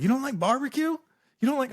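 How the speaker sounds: mock Texan disbelief; incredulous. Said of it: "they're like".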